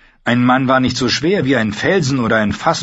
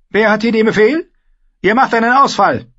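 high, loud battle order; rally them to attack; shouted command; 12 semitones higher, 6 dB louder than usual. Acted. Commander rallies at the gate. (gebrüllter Befehl)

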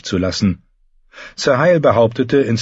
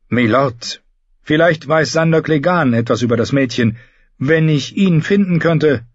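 lightly voiced woman, higher pitched; earnest lady warrior; 6 semitones higher than usual. Brienne to the lord about finding the girl, lightly voiced earnest petitioner. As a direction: offer a hopeful suggestion in a lifted pitch.

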